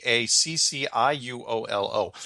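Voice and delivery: letters spelled out one by one, slowly. Shown as a demonstration. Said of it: "but I can't pronounce it".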